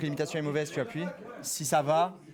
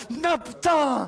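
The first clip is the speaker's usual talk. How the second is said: pained shout; 6 semitones higher, 7 dB louder, much slower than usual. Real, not acted. Zapped by the taser.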